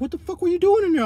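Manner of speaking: high, punchy mock of his boy's shocked question; friend's startled question. D Dumpers asks why he was in the SUV, high and startled. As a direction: throw it up high like a stunned friend catching you. Friend confronts him outside car.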